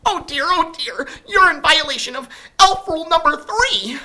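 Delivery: very high, loud fretful elf voice; fussing elf. A narrator's reading. Elf scolding Sophie about the dress code, high fuss. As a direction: fret over the rule like a fussy elf. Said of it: "fussy elf".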